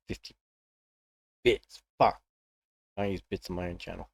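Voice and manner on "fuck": ordinary talk, nothing marked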